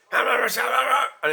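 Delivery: imitating her high, slow, loud Glaswegian garble; loud Glaswegian gabble. Mocking the loud Glaswegian woman's garbled rant. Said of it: "like a Glaswegian accent".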